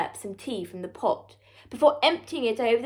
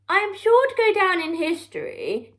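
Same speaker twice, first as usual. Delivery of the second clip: a hint of a self-important prime minister; lightly voiced, higher, droning on about himself; mild puffed-up politician; pompous prattle; 7 semitones higher, 4 dB louder than usual. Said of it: "he prattled".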